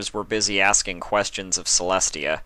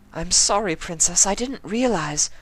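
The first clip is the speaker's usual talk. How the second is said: high, posh-lady voice; high, elegant lady voice, contrite; refined lady; 12 semitones higher than usual. Acted. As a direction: apologize like a dainty fashionista. Rarity's voice.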